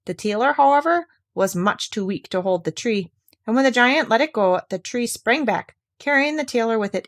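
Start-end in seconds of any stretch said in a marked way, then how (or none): none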